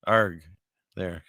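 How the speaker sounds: half-hearted low pirate "arg"; mock pirate. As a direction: toss out a token pirate growl. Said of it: "supposed to talk like a pirate".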